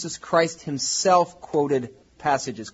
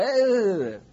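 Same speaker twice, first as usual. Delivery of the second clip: brush-off; 7 semitones higher than usual. Retold or imitated. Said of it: "And I go".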